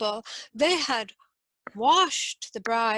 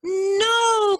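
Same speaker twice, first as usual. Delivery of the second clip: much higher, loud anguished shout; cried out; 10 semitones higher, 9 dB louder than usual. A narrator's reading. Court ladies crying out against the match. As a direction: wail it in horror. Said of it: "cried the ladies".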